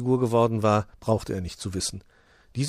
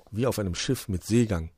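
usual speaking voice

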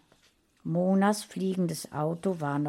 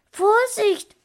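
put-on high, loud boy's warning cry; warning call; 8 dB louder than usual. A narrator's reading. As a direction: shout a sudden warning, voice jumping up. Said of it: "rief er". (hoher, lauter Warnruf)

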